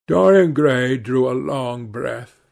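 ordinary delivery

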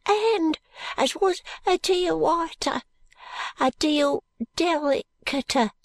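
put-on high, thin ragged boy; street urchin voice. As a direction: speak like a timid street boy.

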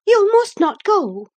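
high, loud, forceful forbidding; energetic exclamation. A narrator's reading. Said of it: "she exclaimed energetically".

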